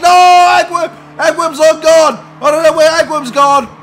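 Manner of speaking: high, loud and frantic as the car vanishes; shouting in mock panic at a vanished car; excited shout. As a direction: yell it like a commentator losing his mind. Commentator sees a car vanish.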